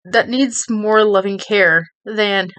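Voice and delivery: ordinary talk, nothing marked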